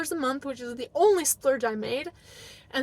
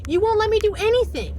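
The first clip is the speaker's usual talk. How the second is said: louder insistent repeat; 9 semitones higher, 4 dB louder than usual. Retold or imitated. Wife insists to husband she is allowed nothing, raised and loud. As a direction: say it again much higher and louder, pleading.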